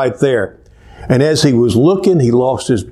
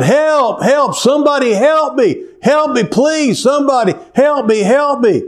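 high desperate cries for help, much higher; yelling for help; 11 semitones higher than usual. Acted. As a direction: call out for help from the cliff. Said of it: "he started yelling out".

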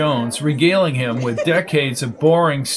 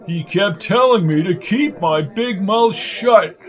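higher-pitched blowhard complaining; pitched-up, indignant character voice; aggrieved old bore; 6 semitones higher than usual. Acted. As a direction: grumble like a wounded windbag. Lark complains to Jones.

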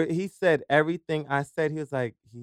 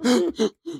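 fake gasp; 9 semitones higher, 4 dB louder than usual. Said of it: "you having an attack".

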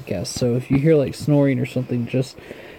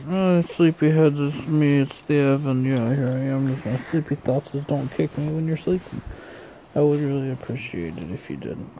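soft sleepy narration; 6 dB quieter than usual. Acted. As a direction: murmur it like a bedtime story. Sleepy time thoughts host voice.

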